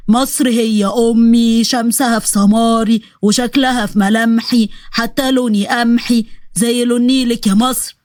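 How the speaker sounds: very slow, solemn declaiming; slow, hammed-up patriotic verse; mock patriotic recital. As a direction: recite it like a grand ode to Egypt.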